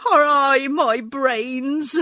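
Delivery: high, loud, drawn-out wail. A narrator's reading. Scarecrow despairing of his brains, wailing. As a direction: cry it out slowly, tearfully. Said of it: "wailed the Scarecrow".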